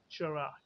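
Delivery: चुरा as a blended "chruh"; fuse t and r into चुरा; chr sound. Shown as a demonstration. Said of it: "मिलके बना दिया इन्होंने". (चुरा, ट और र को मिलाकर)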